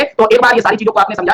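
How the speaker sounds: ordinary talk, nothing marked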